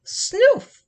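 a high snuffly dog noise; dog snuffle. A narrator's reading.

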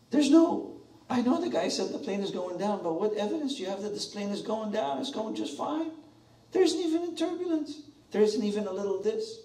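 high, exasperated complaining; whiny doubting passenger. Pretend. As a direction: argue like a fed-up passenger who sees no danger.

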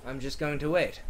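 normal talking voice

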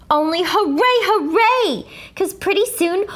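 rising gleeful cheer; childlike double hooray; happy hooray. Acted. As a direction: cheer like a relieved kid. Celebrating janitor's arrival.

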